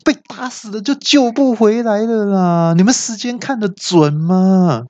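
high whiny alarmed cry; panicked yell. Acted. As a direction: shriek in disbelief. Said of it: "我就大叫说".

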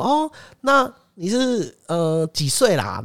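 high, slow drawn-out question; mock salesman fishing for age. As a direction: stretch it out like a smooth salesman. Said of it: "然后他就说".

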